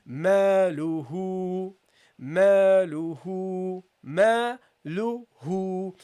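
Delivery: very slow "Maluhu", "ma" and "hu" held equally; measured teaching recitation; equal long vowels. Shown as a demonstration. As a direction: stretch both "ma" and "hu" evenly. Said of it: "the duration of hu is the same as ma".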